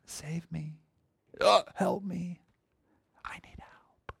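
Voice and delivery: barely audible, feeble call for help; very soft, fading into a whisper; half-hearted plea. Shown as a demonstration. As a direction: ask for rescue with no urgency at all. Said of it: "That's not how they're gonna do it".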